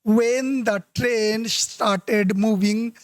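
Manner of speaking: slowly read-out wrong phrase; slow pointed reading. Shown as a demonstration. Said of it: "slow reading of the part".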